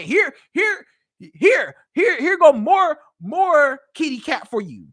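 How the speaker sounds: loud, eager, drawn-out offering; mock generous offering. A man mimics his girlfriend rewarding him, eager and loud. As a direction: hand it over like a prize, slow and loud. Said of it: "You basically said".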